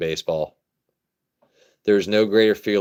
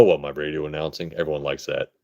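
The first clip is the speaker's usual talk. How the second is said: ordinary talk, nothing marked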